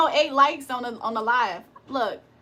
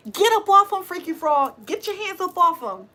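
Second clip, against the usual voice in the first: mock exorcist command; 5 semitones higher than usual.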